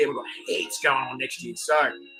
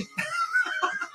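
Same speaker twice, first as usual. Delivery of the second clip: high squeal of laughter; 6 dB quieter than usual. Real, not acted. Laughing at own joke.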